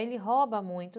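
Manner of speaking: "rouba" como róba na frase; pronúncia popular errada. Shown as a demonstration. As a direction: abra o O de "rouba", sem ditongo. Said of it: "as pessoas dizem rouba". ("rouba" à moda popular, róba)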